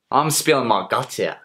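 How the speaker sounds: loud complaint, climbing in pitch; indignant. Acted. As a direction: berate the writer, building to an outburst. Berates the writer for not listening.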